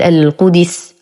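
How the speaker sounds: القدس with "i" on the dal, "qudis"; mispronunciation demo. Shown as a demonstration. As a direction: wrongly break the dal of القدس into "di". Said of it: "يقرأها خطأ فيقول". (القدس بكسر الدال خطأً)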